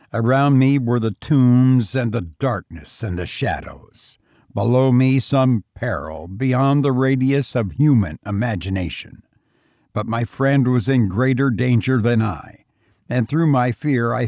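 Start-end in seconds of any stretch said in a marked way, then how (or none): none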